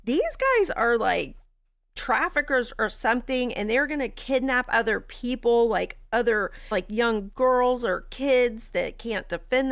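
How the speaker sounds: high, loud enraged rant; high furious rant, laughing at the end; full rage. Retold or imitated. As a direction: rant it in rising fury. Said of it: "I was in a full rage".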